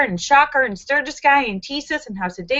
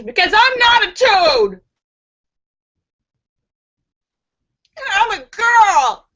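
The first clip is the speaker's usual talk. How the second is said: loud, high playful objection; mock protest; 8 semitones higher, 4 dB louder than usual. Real, not acted. Host protesting a viewer's toad joke, loud and high. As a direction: pretend to be offended, loudly.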